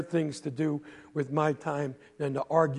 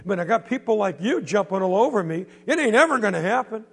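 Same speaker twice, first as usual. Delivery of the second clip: loud, higher, fed-up outburst; angry teen; 5 semitones higher, 5 dB louder than usual. Acted. Teenage boy yelling back at the bully, angry and loud. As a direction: get right in his face and let it out.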